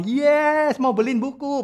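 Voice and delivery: high-pitched gleeful mimicry; oblivious cheering child. Child cheering while parent is angry, gleeful. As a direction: mimic a child delighted despite the telling-off. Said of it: "anaknya malah".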